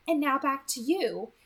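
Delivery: a hint of an amused, knowing girl; Edith's voice. Edith teasing Beau about his questions, amused lilt.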